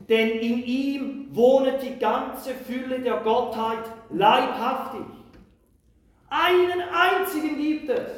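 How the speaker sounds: loud, rising sermon proclamation; preaching at full force. Acted. Preacher to congregation about Christ, loud fervent proclamation. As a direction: proclaim it to the whole church, building.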